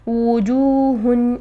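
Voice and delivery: وجوہن drawn out very slowly; slow drill demo. Shown as a demonstration. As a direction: stretch وجوہن out for students. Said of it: "اور پھر دیکھیے".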